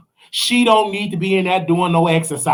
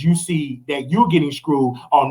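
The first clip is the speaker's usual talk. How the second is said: normal talking voice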